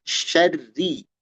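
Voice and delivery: "Syarri" with long sh and light trilled r; thin trilled ro. Shown as a demonstration. Teacher encouraging practice, "Syarri" thin and trilled. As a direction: draw out "Syarri" thin, near "sherri". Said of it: "dengan dilatih bisa".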